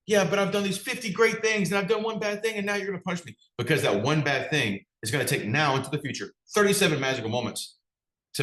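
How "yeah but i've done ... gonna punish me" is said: high, whiny imitation of someone making excuses; whiny mock protest. Customer caught messing up, mock whining in defense. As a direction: play the pouty person listing their good deeds. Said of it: "And you're like".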